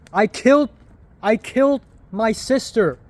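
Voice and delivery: loud, high, hammed-up confession; mock confession shout. Drunk vlogger shouting a fake confession in the street, loud and hammed-up. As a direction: shout it to the empty street, playing guilty.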